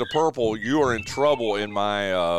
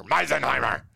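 Meisenheimer in a hammed-up harsh German accent; mean German accent; 2 semitones higher than usual. Shown as a demonstration. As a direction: growl Meisenheimer like a stern German. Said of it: "like, mean German accent in there".

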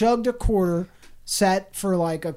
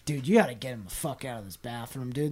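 counter guy's quote; 7 semitones lower, 8 dB quieter, faster than usual. Retold or imitated. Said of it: "the dude working behind the counter was like".

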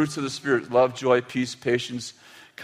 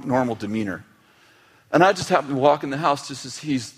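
usual speaking voice

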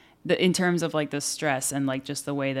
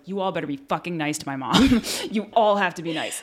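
louder, laughing commands to the club; rally the troops, half laughing; bossy kid orders; 5 semitones higher, 6 dB louder than usual. Retold or imitated. Kid warning club friends to be nice to mom, loud and laughing. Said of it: "I was like".

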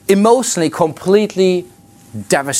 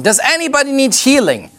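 mock shout; 9 semitones higher than usual. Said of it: "just shouting".

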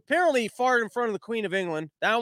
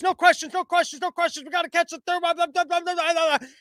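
higher, brisk no-questions patter; rushed handler; 9 semitones higher than usual. Retold or imitated. Handler cuts off reporters so Biden can leave, high and brisk. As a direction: shoo the press away fast.

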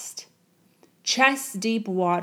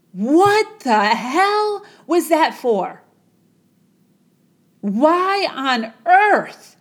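slow, loud, much higher furious shout; furious outburst; 5 semitones higher, 6 dB louder, slower than usual. Acted. Young man to the guru after nearly drowning, shouting furiously. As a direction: stretch every word out in outrage.

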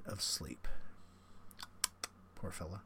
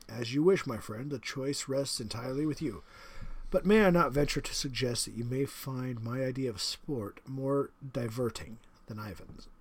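the general, menacing; 4 dB louder than usual. Acted. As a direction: savour the threat like a proud host. General suggests, character voice.

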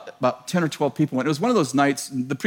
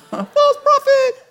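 much higher, slow, laughing taunt; slow high laughing put-down; mock divine verdict; 6 dB louder, much slower than usual. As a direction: tease him in a squeaky laughing voice. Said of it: "I heard the Lord laughing".

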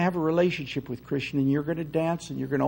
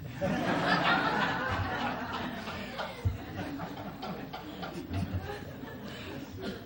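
long laugh; 8 dB quieter than usual. Real, not acted. Laughs at own joke.

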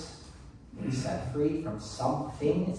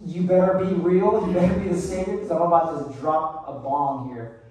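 loud, rising challenge to Jesus; bold prayer; 3 semitones higher, 6 dB louder than usual. Shown as a demonstration. Preacher urging the congregation to pray boldly, calling out loudly. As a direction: dare him, loud and high, to be real. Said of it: "Just say".

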